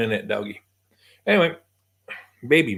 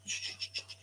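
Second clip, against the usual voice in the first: soft soothing shush; 20 dB quieter, faster than usual. Real, not acted. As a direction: hush the frightened dog. Calming startled dog.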